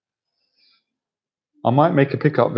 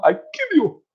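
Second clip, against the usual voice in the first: very high, squeaky mock threat; comic terrorist impression; slower than usual. Amateur impressionist attempting a terrorist, high comic threat. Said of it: "I can't do a terrorist impression".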